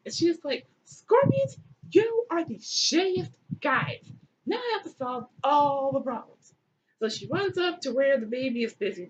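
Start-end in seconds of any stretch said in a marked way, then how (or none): 0.9-6.5 mock exasperated scolding. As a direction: scold them in hammed-up exasperation. Isis scolds the scorpions.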